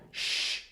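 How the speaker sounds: a long, breathy, unvoiced "sh"; strong sh. Shown as a demonstration. Teacher showing the German sch sound, strong and hissed. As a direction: push out a strong "sh" with no voice. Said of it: "Sh, strong".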